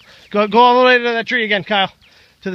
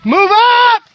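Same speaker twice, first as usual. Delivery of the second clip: shouted command; 8 semitones higher, 4 dB louder, much slower than usual. Real, not acted. Leader calling team forward.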